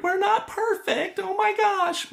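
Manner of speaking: high, fretful mock panic; worried panic. Choir director frets over her middle schoolers, high panicky voice. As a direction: squeak out the worry before the concert. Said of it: "I was worried about my middle school group".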